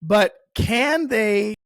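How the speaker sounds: CAN drawn out high and loud; emphatic challenge. Real, not acted. Podcaster doubting Disney, emphatic challenge with "can" stretched high. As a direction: lean hard and slow on "can".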